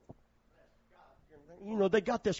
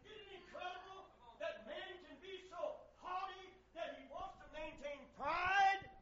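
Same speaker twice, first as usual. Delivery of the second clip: high strained voice; 10 semitones higher, 17 dB quieter than usual. Real, not acted. High thin preaching voice.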